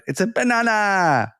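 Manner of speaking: playful Minion cry. Acted. Child obsessed with Minions spots yellow, high playful cry.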